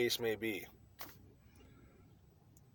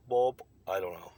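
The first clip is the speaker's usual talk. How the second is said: a brief, admittedly bad try at saying Bob with a Chinese accent; 4 dB louder than usual. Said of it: "My Chinese accent sucks".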